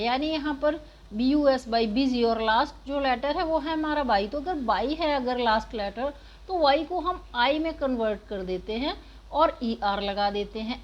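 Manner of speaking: ordinary talk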